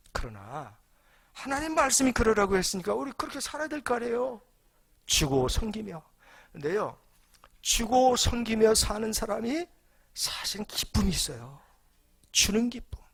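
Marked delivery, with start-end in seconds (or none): 1.3-13.0 loud, high, emphatic sermon delivery; rising fervent preacher's call; preaching at full force. Acted. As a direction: preach it out to the whole congregation. Preacher driving home his point.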